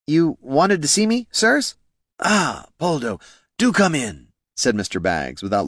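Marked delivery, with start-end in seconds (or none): 0.1-1.8 high, tentative question to the bosses; deferential underling. A narrator's reading. Underling nervously greets his bosses. 2.2-4.3 high, drawn-out welcome; grand boss. A narrator's reading.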